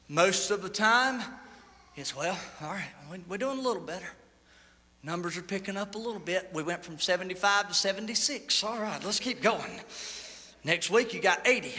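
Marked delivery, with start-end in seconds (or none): 2.2-9.8 low, soft, deflated shrug of a voice; mock half-hearted. Preacher imitating a church barely growing, low and subdued. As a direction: mumble it like a tired, mildly pleased church.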